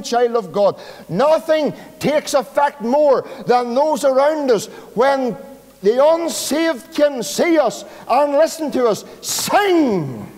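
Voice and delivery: high, loud fiery sermon delivery; preaching at full force. Acted. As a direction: preach it out to the congregation, rising with fervour.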